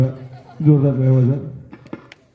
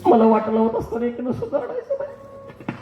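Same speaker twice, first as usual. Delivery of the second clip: higher, laughing put-on bride; mock bride voice; 11 semitones higher, 4 dB quieter than usual. MC voicing a laughing bride who refuses to cry. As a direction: play the teasing bride leaving home, giggling.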